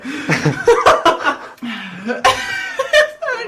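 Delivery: high cackling fake laugh; hyena laugh. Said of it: "so they can hear it".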